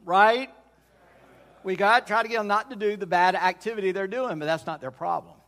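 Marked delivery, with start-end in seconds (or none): 0.1-0.5 Right punched higher and louder, rising; Right said louder and higher as a call for a reply; emphatic prompt. Shown as a demonstration. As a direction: demand right from the room. Preacher presses congregation for answer.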